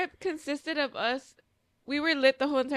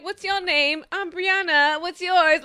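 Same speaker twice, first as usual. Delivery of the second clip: higher, louder mock-British introduction; fake British accent; 5 semitones higher, 5 dB louder, slower than usual. Drunk friend meeting the dog owner, fake British accent. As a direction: chirp it in an imitated British accent. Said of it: "both talking to each other in an accent".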